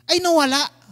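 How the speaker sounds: ordinary talk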